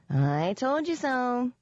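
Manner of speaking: high, slow, lilting little pixie voice; tiny sing-song voice. A narrator's reading. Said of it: "a tiny sing-song voice".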